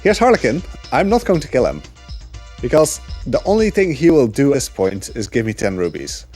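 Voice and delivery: normal talking voice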